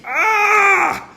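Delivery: long, high, loud anguished scream; acted wail for lost comrades; grief scream. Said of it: "He just screams".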